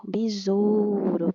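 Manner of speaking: slow "Besouro" with a stretched stressed syllable; drawn-out word. Shown as a demonstration. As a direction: draw out "Besouro", lightly tapping the R. Teacher models a weak-R word. ("Besouro" bem alongado, com R fraco)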